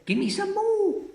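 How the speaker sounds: put-on high cackling old woman; old Grandma voice. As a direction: relish the medicine greedily.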